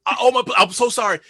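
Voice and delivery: high, flustered apology, tumbling over itself; super apologetic. Retold or imitated. Actor who bumped into the star, super apologetic. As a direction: re-enact a gushing, panicked sorry. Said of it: "like, super apologetic".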